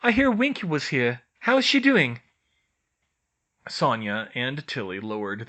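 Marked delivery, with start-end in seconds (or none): 0.0-2.2 Hermione voice. A narrator's reading. Hermione asking.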